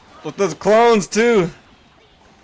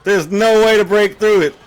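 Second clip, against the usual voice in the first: ordinary talk, nothing marked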